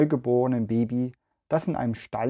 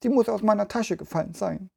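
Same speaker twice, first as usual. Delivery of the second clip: put-on a touch of a sobbing little girl, higher and fast; understated tearful child; mild sobbing girl; 8 semitones higher, faster than usual. A narrator's reading. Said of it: "schluchzte das Mädchen".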